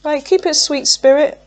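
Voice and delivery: lilting high appeal; sing-song plea. Real, not acted. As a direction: coax it along in a lilt. Cooing to Spirit about cards.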